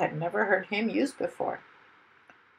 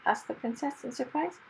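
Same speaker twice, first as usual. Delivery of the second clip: ordinary delivery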